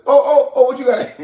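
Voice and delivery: imitating his own eager welcome; excited greeting. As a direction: greet the borrower with hyped energy. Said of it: "I'll be like".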